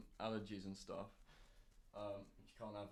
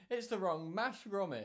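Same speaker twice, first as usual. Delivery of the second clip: higher, louder cartoon Wallace delivery; Wallace-style quip; 8 semitones higher, 5 dB louder than usual. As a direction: ham it up like a fussy cartoon inventor. Quoting Wallace and Gromit line.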